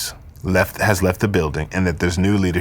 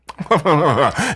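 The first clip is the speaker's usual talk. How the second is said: haughty British laugh; 6 semitones higher than usual. Retold or imitated. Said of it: "Anytime you're talking haughty, taughty British".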